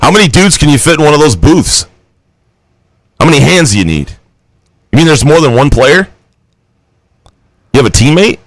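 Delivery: ordinary talk, nothing marked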